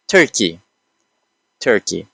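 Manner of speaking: Turkey said alone with a falling tune; pronunciation model. Shown as a demonstration. Teacher pronouncing a country for learners, carefully, falling at the end. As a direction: model Turkey carefully, pause, then repeat it. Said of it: "pronounce all these countries".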